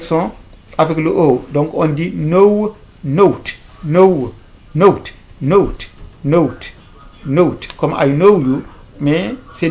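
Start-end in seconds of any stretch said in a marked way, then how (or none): 2.1-7.7 note as "noh-oot", audible w glide. Shown as a demonstration. Teacher drilling the hidden w, gliding long o. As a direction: draw out "no" into oo, then close with t for "note". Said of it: "On entend le son W".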